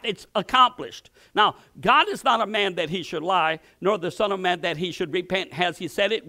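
usual speaking voice

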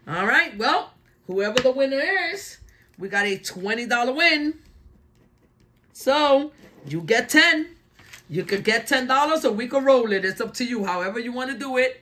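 normal talking voice

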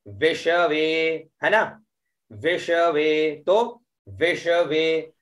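विषवे spoken slowly, syllables spaced; slow careful pronunciation. Shown as a demonstration. Teacher drilling विषवे for students. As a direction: pronounce विषवे slowly as vi-sha-ve. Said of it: "इसका उच्चारण करेंगे विषवे".

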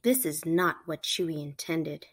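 usual speaking voice

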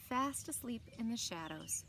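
ordinary delivery, nothing marked

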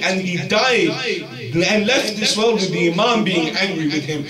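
fiery preaching. Acted. Preacher warning the youth about swearing, fiery sermon. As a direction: preach it out at full force to the crowd.

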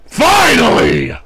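slow, loud heel shout; wrestling promo. Acted. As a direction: bellow it to the arena crowd. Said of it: "gonna cut a promo".

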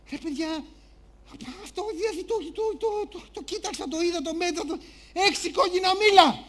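high, halting self-defence; flustered George. Acted. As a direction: stammer a protest, firmer at the end. George defends himself to family.

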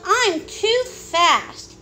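high, very drawn-out boast; playful yell. A narrator's reading. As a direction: stretch it out like a running child. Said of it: "she yelled".

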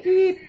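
very high chirped beep; beep noise. Pretend. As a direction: chirp like a machine. Imitating a beep.

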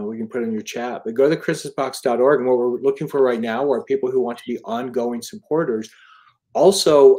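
usual speaking voice

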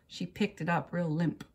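usual speaking voice

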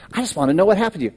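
lightly voiced classmate insisting she must know; pushy classmate. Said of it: "she was like this".

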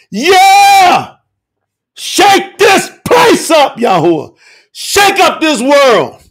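fervent calls flung high, then falling; rally the congregation and cry out to God; exultant preaching. Real, not acted. Exhorts and prays at full force.

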